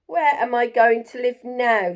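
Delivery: loud, cross complaint; snapping porcupine. Acted. Angry porcupine scolds Jim for burning its leaves, loud and cross. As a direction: tell him off sharply. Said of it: "snapped the porcupine".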